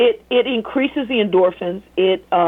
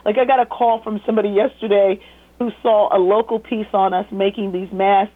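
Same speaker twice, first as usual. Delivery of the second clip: ordinary delivery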